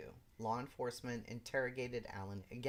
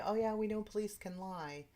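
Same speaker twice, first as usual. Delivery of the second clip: mock casual; 10 semitones higher, 6 dB louder than usual. Mocking a common saying.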